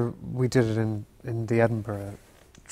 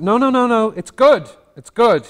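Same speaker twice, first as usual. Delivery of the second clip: loud, higher, insistent no no no; retold emphatic insistence; 11 semitones higher, 11 dB louder than usual. The grieving mother insisting to the actor, loud and high. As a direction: insist it's good, louder and higher. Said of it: "She was saying this as she was walking".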